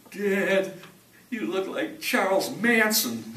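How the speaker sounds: high, loud imitation of the son; son's voice. Quoting his son at the rim, in a high loud voice. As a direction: pitch it way up like the shocked son. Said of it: "he saw me and he says".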